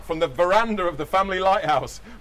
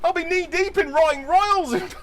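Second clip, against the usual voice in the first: panicky Larry; 10 semitones higher than usual. Acted.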